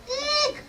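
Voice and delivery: tiny high-pitched eek; mock squeal. Giant's wife spotting a boy, small high squeal. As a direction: squeak softly in pretend fright.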